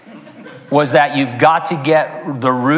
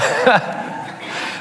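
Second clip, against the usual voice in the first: breathy laugh; 9 semitones higher, 4 dB quieter than usual. Real, not acted. Laughing at own joke.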